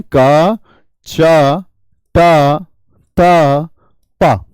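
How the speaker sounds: each syllable "का" "चा" "टा" "ता" "पा" said alone and firmly, with a pause between; separated syllables. Shown as a demonstration. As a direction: count out the sounds one by one for the class. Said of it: "क्या बोलते हैं".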